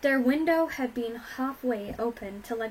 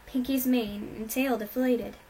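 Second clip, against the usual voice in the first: ordinary delivery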